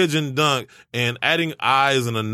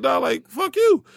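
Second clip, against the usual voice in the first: high, drawn-out retort; retold comeback, slow and pitched way up; cussing back; 13 semitones higher, slower than usual. Target cussing back at the telemarketer, high slow retort. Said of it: "we going back and forth".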